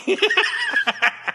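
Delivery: high cackling villain laugh; evil villain laugh. Acted. Villain gloating over his ransom note, evil cackle. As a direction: cackle like a mad professor.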